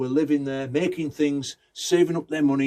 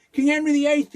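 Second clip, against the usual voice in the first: high, louder astonished cry; astonished townsman; 14 semitones higher, 5 dB louder than usual. Acted. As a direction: exclaim it as if they should know.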